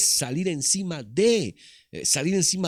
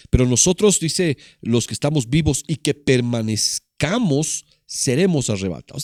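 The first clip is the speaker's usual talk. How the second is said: reading the verse out loud, with weight; booming preacher reading scripture; 7 dB louder than usual. Acted. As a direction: proclaim the verse to the church.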